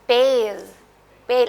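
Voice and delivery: पेल with the vowel dragged out long; teacher lengthening the vowel in पेल; drawn-out vowel. Shown as a demonstration. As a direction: stretch the ए in पेल. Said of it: "अब थोड़ी drag हो रही ना एक ही sound".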